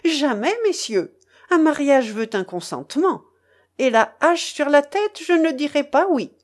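high, forceful declaration of refusal; energetic refusal. A narrator's reading. Young woman refuses the marriage before the gentlemen, energetically. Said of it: "dit-elle avec énergie".

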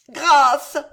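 loud, high cry for mercy; panicked high shout; begging for mercy. A narrator's reading. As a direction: cry out in desperate appeal. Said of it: "répéta Alice, qui tomba à genoux".

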